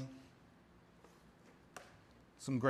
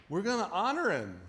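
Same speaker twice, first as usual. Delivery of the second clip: ordinary delivery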